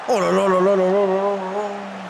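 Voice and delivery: slow, stretched "oh là là" of dismay; exasperated drawn-out groan. Real, not acted. Streamer despairing at a teammate's blunder, slow drawn-out groan. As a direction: drag it out, despairing at the player. Player makes a mistake.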